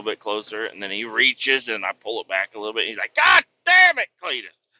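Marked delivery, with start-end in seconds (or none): 3.1-4.7 higher, louder mock outburst of a fed-up mechanic; exasperated yell. Mechanic yelling at a prankster coworker, higher, louder exasperated shout. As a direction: blurt it out like a teased coworker losing patience.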